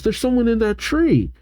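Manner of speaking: high-pitched, lightly voiced kid; Clement. Clement pointing out someone in the tree, lightly voiced. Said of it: "Clement says".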